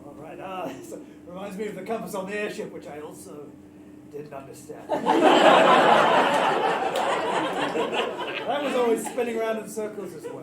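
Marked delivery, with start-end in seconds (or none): 4.9-8.4 high unvoiced burst of laughing; loud laugh. Real, not acted. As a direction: let out a big laugh.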